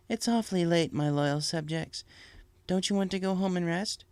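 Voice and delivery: lightly voiced princess; Celestia, gentle and regal. Celestia addresses late subjects.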